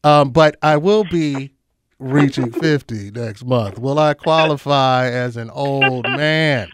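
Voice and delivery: put-on slow, deliberate authoritative old man voice; a hint of a stern elder. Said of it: "that was that old man authoritative".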